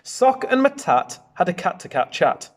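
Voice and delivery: ordinary delivery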